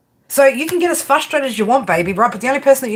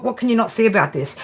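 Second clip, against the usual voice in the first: ordinary delivery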